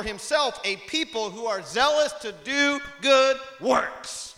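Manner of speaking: high, loud, ringing scripture read; full-force preaching. Acted. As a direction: proclaim it to the whole congregation.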